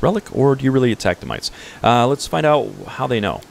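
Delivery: ordinary talk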